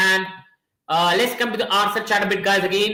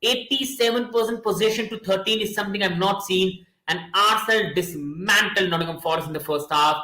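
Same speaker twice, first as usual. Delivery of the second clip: ordinary talk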